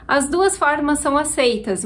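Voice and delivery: normal talking voice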